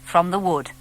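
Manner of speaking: plain reading voice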